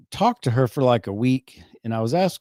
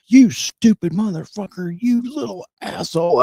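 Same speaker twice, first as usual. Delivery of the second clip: much higher, strained mock yell at the kids; mock abusive dad; 9 semitones higher, 3 dB louder than usual. A dad mock-cursing at his kids, high and hammed-up. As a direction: ham up a cursing parent, pitched way up.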